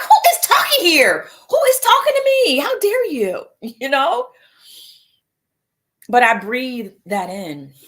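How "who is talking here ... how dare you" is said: much higher, louder indignant shout; hammed-up affront at the voice in her head; mock outrage.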